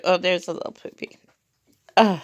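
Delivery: usual speaking voice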